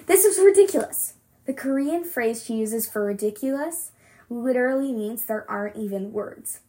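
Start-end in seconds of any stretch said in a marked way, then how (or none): 0.1-1.1 high, loud indignant outburst; mother rejecting the plan, loud and outraged. Acted. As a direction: scoff it out in disbelief. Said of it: "Uma spits out".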